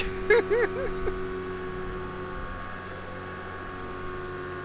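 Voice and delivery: long, breathy chuckling fit; tickled, voiceless laugh; real laughter. Real, not acted. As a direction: laugh it out until it trails away. Laughing at the Irish man's line.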